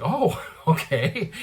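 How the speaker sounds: slow, laughing "Oh, okay"; laughing realization. Retold or imitated. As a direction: let the penny drop with a laugh. Realizing the eyesight is healed.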